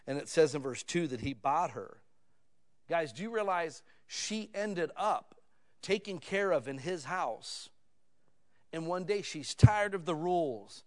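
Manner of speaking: ordinary delivery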